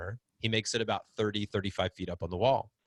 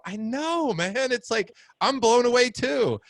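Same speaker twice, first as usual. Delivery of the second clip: higher, louder thrilled agreement; excited; 12 semitones higher, 6 dB louder than usual. Retold or imitated. Dad telling a stranger he's amazed, excited. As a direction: gush that you're amazed too. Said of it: "I go".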